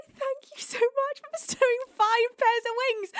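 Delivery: lightly hammed-up chipmunk thanks; playing Kentai. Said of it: "I'm going to play Kentai".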